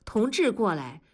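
ordinary delivery

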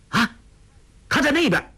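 sudden high cry to misdirect; gasp, then call out high-pitched; urgent pointing-out exclamation; feigned alarm. A narrator's reading. Pointing suddenly to distract others.

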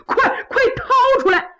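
high, urgent cry with a laugh; the Eighth Prince panics and orders the guards, frantic. Acted. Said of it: "可把八王急坏了".

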